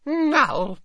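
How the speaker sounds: put-on high, bright happy old lady. A narrator's reading. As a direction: say it with glee. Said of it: "said Hepzibah happily".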